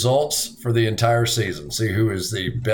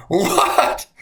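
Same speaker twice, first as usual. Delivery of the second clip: high, rising yelp of disbelief; astonished; 13 semitones higher than usual. Retold or imitated. Listener retelling his reaction to a golf excuse, astonished. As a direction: replay your astonishment, pitched way up.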